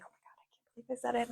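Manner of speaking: fast breathless whisper; panicked whisper. Retold or imitated. Back inside her pod after yelling, fast shocked whisper. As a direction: hiss it to yourself in shock. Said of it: "I was like".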